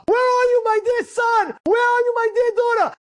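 high, loud searching cry; anguished calling. Retold or imitated. God searching for His son or daughter, calling out. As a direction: call out longingly to a lost child.

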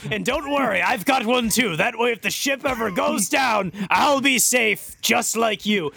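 loud, high sailor shout; captain shouting over wind. Pretend. Captain yells reassurance to skipper, loud and high. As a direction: holler it across the deck.

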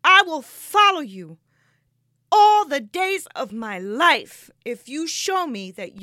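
fervent promise ringing out; impassioned vow. Retold or imitated. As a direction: vow it forcefully, stretching the words. Vow to God, retold.

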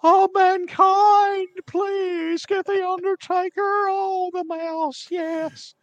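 high, slow, whiny impression of the manager; Paul Bearer impression. Co-host imitating Paul Bearer calling to Mankind, high and drawn out. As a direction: stretch it out in a high, creepy whine. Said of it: "could you imagine Paul Bearer going".

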